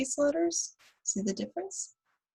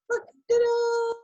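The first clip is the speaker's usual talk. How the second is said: much higher, showy ta-da; 5 dB louder, slower than usual. Real, not acted. Teacher revealing her coconut tree to the kids, showy. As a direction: present it like a magic trick.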